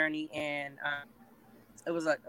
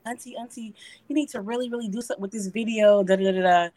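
imitating nieces; 6 semitones higher, 6 dB louder than usual. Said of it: "And I'm like".

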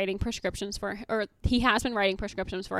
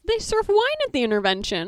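higher, slowed disbelief; indignant; 11 semitones higher, 6 dB louder than usual. Retold or imitated. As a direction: lay out the absurdity, pitched up. Indignant retelling.

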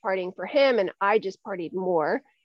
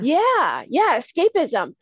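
emphatic agreement; 7 semitones higher, 6 dB louder, slower than usual. Real, not acted.

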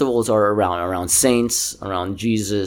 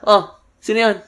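Filipino host voice; 11 semitones higher than usual. Retold or imitated. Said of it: "Back home, somebody's knocking".

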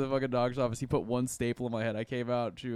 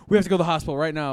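fast, loud mock urgency; retold freaking out; 4 semitones higher, 8 dB louder, faster than usual. Mom insisting on the hospital, imitated frantic urgency. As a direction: rush it out like a panicking mom. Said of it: "kept freaking out".

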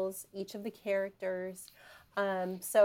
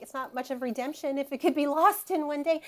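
lightly voiced Jesus, a touch higher and louder; gently quoting Jesus; 5 semitones higher, 5 dB louder than usual. Retelling a scene to the host, lightly voicing Jesus. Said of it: "And Jesus is like".

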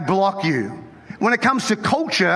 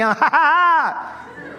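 amused laugh; 8 semitones higher than usual. Pretend. Preacher finds fake accents funny, amused laugh. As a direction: burst into a pitched-up string of ha-has.

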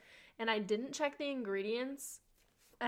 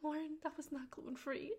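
a hint of a worried mother, higher and softer; lightly voiced grave mom; 6 semitones higher, 6 dB quieter than usual. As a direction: deliver the bad news gently as mom. Mom breaks bad news.

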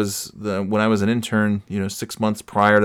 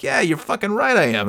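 high, cocky agreement; hammed-up brash comeback; mock trash talk; 9 semitones higher than usual. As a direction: own the insult with playful swagger. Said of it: "I'm shit-talking him back".

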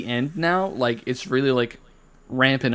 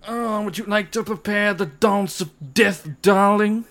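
high, put-on grand society voice; hammed-up theatrical darling; 7 semitones higher than usual. Friend mocks the long-A dance, hammed-up high darling voice. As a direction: play an affected darling, pitched up. Mock-posh riff on saying dance.

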